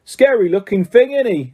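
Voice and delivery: lightly voiced cheeky bus conductor; Stan's voice. Stan speaks to Harry.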